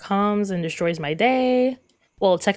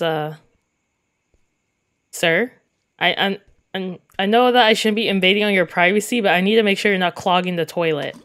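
ordinary talk

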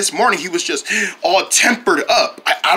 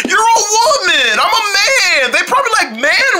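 sobbing, shouting the words out; crying protest; 11 semitones higher than usual. Acted. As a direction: wail it through tears. Lovers' breakup quarrel.

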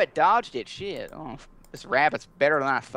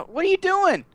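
shocked yelp; 5 dB louder than usual. Real, not acted. Shocked at friend's shooting.

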